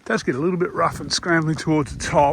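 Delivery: puffing between words; breathless from the climb; out of breath. Real, not acted. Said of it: "as you can hear".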